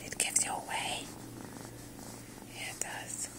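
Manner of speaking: soft secret whisper to a pet. Real, not acted. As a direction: lean in and whisper it. Whispering to her cat.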